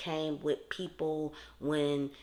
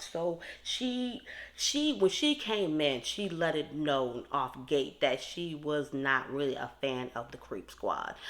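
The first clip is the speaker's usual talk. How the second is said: ordinary delivery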